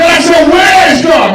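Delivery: very high, loud, slow shout; pastor yelling. Retold or imitated. Pastor roars at terrified little brother, full shout. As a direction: stretch out a booming yell. Pastor yells question again.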